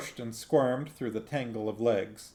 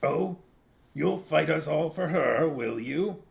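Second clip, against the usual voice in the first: Big Fido voice; 5 semitones higher than usual. Acted. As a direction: make the poodle a paranoid zealot, slowing at the end. Big Fido taunts Gaspode.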